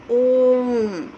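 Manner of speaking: "ओम" held very long, the O stretched well past a normal long vowel; drawn-out pluta vowel. Shown as a demonstration. Teacher shows students the prolonged vowel, "ओम" held very long. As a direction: sing out "ओम" long and full, like a chant. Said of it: "यहाँ बहुत लंबा समय तक जब हम इसको उच्चारण करते हैं".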